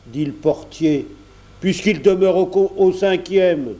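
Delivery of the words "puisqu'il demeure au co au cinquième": raised, higher grumpy porter; exasperated porter's voice; cross retort. A narrator's reading. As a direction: explain it irritably, voice pitched up.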